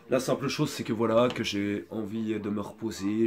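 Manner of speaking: usual speaking voice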